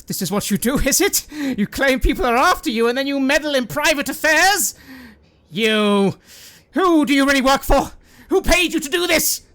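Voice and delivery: high, loud angry interrogation; demanding who paid him; furious accusation. Acted. Masked man accuses, loudly.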